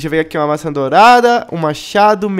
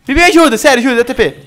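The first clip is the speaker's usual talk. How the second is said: panicked plea; 6 semitones higher, 5 dB louder, faster than usual. Real, not acted. Pedindo socorro no jogo.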